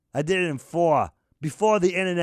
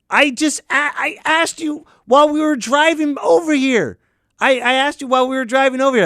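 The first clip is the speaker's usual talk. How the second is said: higher and louder, exasperated; exasperated protest; 6 semitones higher, 6 dB louder than usual. Acted. Reminding the host he asked in the car, exasperated. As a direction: throw up your hands, insisting.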